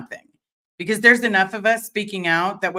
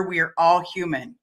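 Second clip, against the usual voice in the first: ordinary talk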